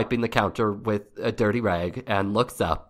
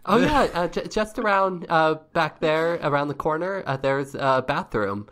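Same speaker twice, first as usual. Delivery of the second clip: awkward, higher-pitched hostess; flustered lunch counter woman; 4 semitones higher than usual. Acted. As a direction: stumble politely through directions as the hostess.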